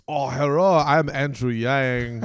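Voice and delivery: slow, drawn-out camp self-introduction. Acted.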